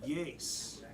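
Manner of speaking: hushed, low horror storyteller; horror movie narration. Acted. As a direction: creep through it quietly, pausing for suspense. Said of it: "in the style of a horror movie".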